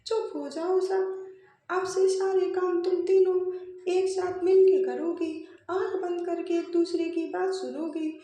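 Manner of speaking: stern scolding. Acted. Mother-in-law silences her three quarrelling bahus, loud and stern. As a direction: silence them and give orders firmly.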